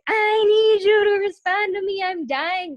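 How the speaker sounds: high, loud, drawn-out mock wail; hammed-up desperate plea, stretched out; anxious desperation. Retelling her anxiety awaiting Jack's reply, desperate. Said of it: "that's how my anxiety would get".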